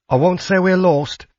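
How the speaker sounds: put-on high-pitched older woman's character voice; older woman traveller. A narrator's reading. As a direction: pitch it well up as the friendly traveller.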